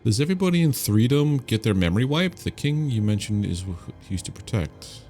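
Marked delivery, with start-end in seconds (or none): none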